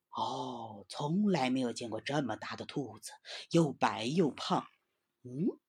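a big cat muttering, sizing up its prey; tiger musing. A narrator's reading. Tiger eyes the snow rabbit, low suspicious musing.

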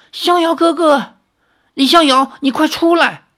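high, loud frightened cry for help; alarmed cry. A narrator's reading. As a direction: scream his name in panic. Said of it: "惊呼道".

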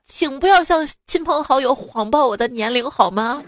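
ordinary delivery

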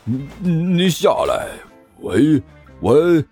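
slow, stammering demon-king challenge; halting, drawn-out villain bellow; shouted demand. Acted. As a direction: call up at an enemy, dragging the words. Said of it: "对着上面的刘阿八大声喊道".

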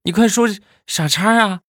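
put-on a kid parroting her father's swearing; imitating dad's curse. Niece copies her dad cursing the other driver, in a high put-on voice. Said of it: "我爸爸都是这么说的".

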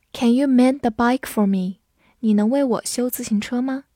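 ordinary talk, nothing marked

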